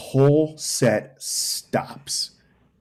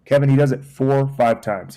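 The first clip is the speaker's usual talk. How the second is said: ordinary talk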